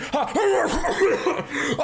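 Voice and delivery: a long laugh pitched high; high forced laugh. Acted. Boss laughs at own joke.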